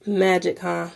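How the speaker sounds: ordinary delivery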